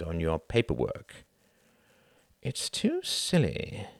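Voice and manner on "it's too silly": slow, thoughtful musing. Acted. Reginald musing to himself about the situation, slow and thoughtful. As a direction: turn it over idly in your mind. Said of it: "mused Reginald".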